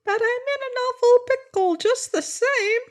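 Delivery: tiny, very high squeaky voice; little orc talking in her ear; very small voice. Acted. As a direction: speak like a creature the size of a bee. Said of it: "said a very small voice".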